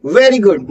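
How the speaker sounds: "very good" with a toothy V and "veh" vowel; proper V sound. Shown as a demonstration. Teacher modelling for learners, "very good" with a V. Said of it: "वी का sound आना है".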